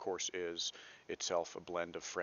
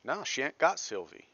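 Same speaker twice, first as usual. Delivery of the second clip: reading a Southern country line higher and louder; country Southern accent; 7 semitones higher, 6 dB louder than usual. Retold or imitated. Teacher reads a Chopin character's line to the class in a higher, louder rural Southern accent. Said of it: "we see phonetic representations like this".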